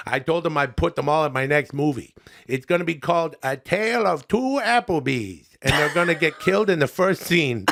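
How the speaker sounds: lightly voiced chummy comic reviewer; folksy buddy-to-buddy review, a little put on; Adam Sandler.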